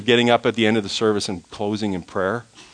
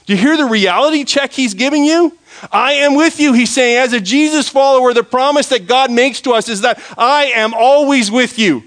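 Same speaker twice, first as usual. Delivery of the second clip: very high, loud, fervent proclamation; full-force preaching; 12 semitones higher, 6 dB louder than usual. Real, not acted. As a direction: cry out the promise to the congregation.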